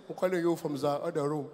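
soft, low presidential voice; Muhammadu Buhari. Retold or imitated. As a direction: speak quietly, as a hushed elder statesman. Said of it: "our president has got the sexiest voice".

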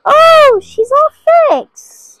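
high, loud, slow squeal; squealing delight. Real, not acted. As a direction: gush over the fixed toy. Squealing at fixed toy.

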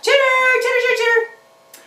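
high, chirpy critter squeak; squeaky puppet chatter. Pretend. Puppet chatters at the children, high and squeaky. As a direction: chatter like a small excited puppet animal.

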